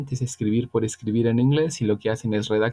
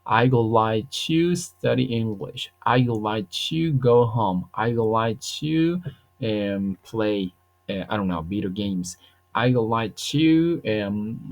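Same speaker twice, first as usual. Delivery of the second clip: slow model answers; slower than usual. Shown as a demonstration. Teacher modelling complete answers for students, slowly. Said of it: "La respuesta completa tendrá que decir".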